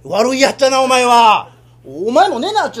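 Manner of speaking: loud exasperated scold. Acted. Father loudly scolding his troublesome son. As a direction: bark it at the brat.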